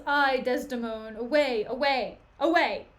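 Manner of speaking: loud repeated command; acted, theatrical dismissal; dismissive outburst. Othello drives Desdemona away.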